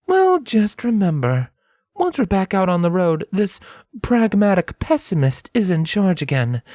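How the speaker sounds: high voice, mock-stern; playfully reclaim command; Scarlet, teasing. A narrator's reading.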